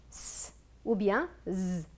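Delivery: hissed "s", then buzzed "z"; sounded-out letters. Shown as a demonstration. Teacher sets the exercise, sounding out "s" and "z". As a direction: say "s" and "z" as pure sounds, not letter names. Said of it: "Complétez les mots dans le texte avec".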